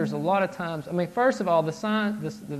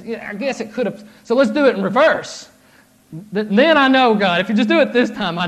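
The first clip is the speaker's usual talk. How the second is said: mock pleading Gideon; 6 semitones higher, 9 dB louder than usual. Gideon pleading with God for a sign, rising to a loud mock plea. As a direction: voice climbing higher and louder as the bargain builds.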